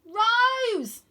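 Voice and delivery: very high, loud call; boy shouts to girl for help. Acted. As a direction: shout her name across the field. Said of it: "I shout".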